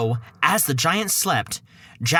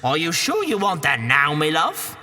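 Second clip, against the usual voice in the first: coaxing Cockney wife. Acted.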